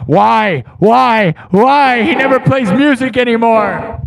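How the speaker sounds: loud, high hammed-up despairing wail; mock wail. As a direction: cry out to the heavens in fake despair. Mock wail about no music.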